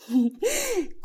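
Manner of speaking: high villainous laugh; gloating laugh. Acted. Plotting woman relishing her choice of victim, gloating laugh. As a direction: laugh high, then let it fall.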